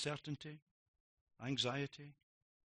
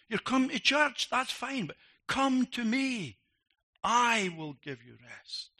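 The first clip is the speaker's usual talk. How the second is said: higher, louder and slower, pleading; impassioned preaching; 11 semitones higher, 11 dB louder, slower than usual. Acted. Preacher pleading with churchgoers to come to Jesus, fervent. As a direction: press the call home to the congregation.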